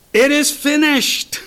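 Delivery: high, loud cry from the cross. Acted. Preacher acts out Jesus crying from the cross, loud cry. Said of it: "cried with a loud voice".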